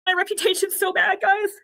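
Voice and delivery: high, teary confession; tearful. Real, not acted. Streamer thanks donors through tears, teary and high. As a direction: choke it out to the viewers. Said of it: "this is happy tears".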